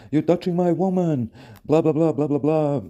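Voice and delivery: high, shouted accusation; jealous boyfriend yelling. Retold or imitated. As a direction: yell like he's guarding his woman. Boyfriend yelling in his face.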